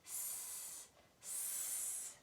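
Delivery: "S, s" as a long soft voiceless hiss; hissed s sound. Shown as a demonstration. Teacher models the snake sound for the class, softly hissed. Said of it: "Sammy Snake says".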